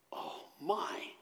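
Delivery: breathy hushed oh my, almost no voice; whispered aside. Real, not acted. Pastor to the congregation, an awed whisper. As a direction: let it slip out as a shocked whisper. Pastor reacts to Jesus' question.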